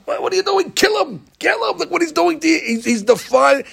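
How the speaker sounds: high, urgent outcry; angels crying out. Retold or imitated. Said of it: "the angels are telling God".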